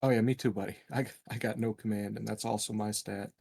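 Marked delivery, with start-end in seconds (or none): none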